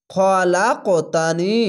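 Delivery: slow Quranic recitation, the ক bounced with a rising echo; qalqala recitation. Shown as a demonstration. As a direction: recite খ লা ক তানি slowly, with a bouncing stop on ক. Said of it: "দেখতে পাচ্ছেন ধাক্কা মেরে ওপরে সাউন্ড যাচ্ছে".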